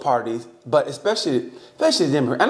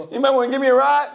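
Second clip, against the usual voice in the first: much higher, calling out for a ride; mock shout; 12 semitones higher, 3 dB louder, faster than usual. Stranded in a wheelchair calling to passersby, mock shout. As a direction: shout it out for help, half-joking. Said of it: "I was all alone".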